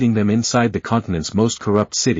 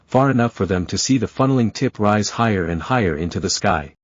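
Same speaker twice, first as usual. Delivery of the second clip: usual speaking voice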